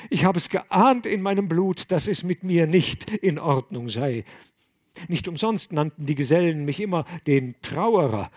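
high, loud lament; a despairing youth crying out his shame; distraught young monk. Acted. Gregors bewailing his birth to the abbot, high and loud.

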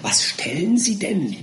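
slow, high, baffled question; puzzled question. A narrator's reading. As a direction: ask it slowly in hushed bewilderment. Winkle baffled by the dogs.

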